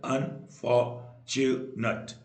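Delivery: Unfortunate slowly split into four beats; syllable by syllable. Shown as a demonstration. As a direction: pause between each syllable of unfortunate. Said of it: "चार syllable".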